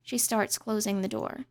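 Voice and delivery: ordinary delivery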